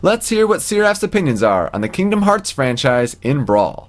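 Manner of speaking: upbeat podcast host read; segment announcer. Acted.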